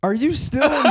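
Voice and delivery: high, bursting laugh through the words; real laughing fit. Real, not acted. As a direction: crack up at your friend. Friend still in game talk.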